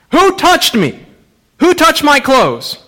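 high, ringing question; calling out. Acted. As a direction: demand an answer from the crowd. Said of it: "turns around and says".

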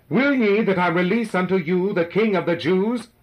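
high, loud question over a crowd. A narrator's reading. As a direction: call it out to the whole mob.

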